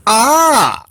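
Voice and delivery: high startled shriek. Acted. Littlest Rabbit startled by the talking nut, shriek. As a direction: shriek in fright. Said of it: "shrieked Littlest Rabbit".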